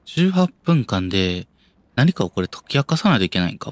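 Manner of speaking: ordinary talk, nothing marked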